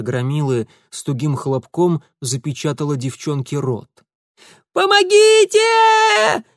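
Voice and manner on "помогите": screaming for help, far higher and louder, slow; full-throat yell. A narrator's reading. Maryanka joins the cry for help, screaming. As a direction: scream at the top of her lungs. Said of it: "во всю глотку подхватила Марьянка".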